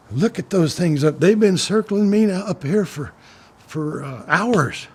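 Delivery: high, loud, agitated retelling of a frightened man; imitating someone badly rattled; worked-up alarm. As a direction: pitch it up in agitated disbelief. Retold alarmed man describing circling birds.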